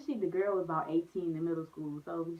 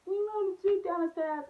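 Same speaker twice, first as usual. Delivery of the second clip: put-on much higher and louder, wailing kid voice; crying kids; 12 semitones higher, 4 dB louder than usual. Kids telling dad they rode the jeep down, high wailing. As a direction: wail it like a sobbing little kid. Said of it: "And we said".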